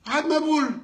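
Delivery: usual speaking voice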